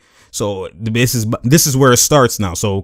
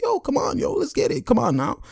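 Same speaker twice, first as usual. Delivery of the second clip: very high, softened imitation of coaxing; Cousins urging; 4 dB quieter than usual. Cousins slapping a teammate back into focus, urging high. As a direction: coax in a squeaky high pitch.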